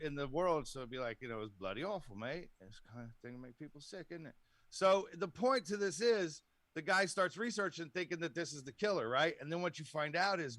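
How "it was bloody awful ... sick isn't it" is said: put-on British accent. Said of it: "in the other parts of the world".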